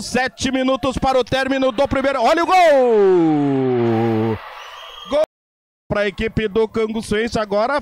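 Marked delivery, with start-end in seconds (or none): 2.2-5.2 drawn-out soaring goal shout; long goal call. Real, not acted.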